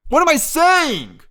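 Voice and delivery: loud, high, drawn-out wail at yourself; exasperated outburst. Real, not acted. Host botches his explanation, high exasperated yell at himself. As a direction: cry out at your own blunder, stretching it.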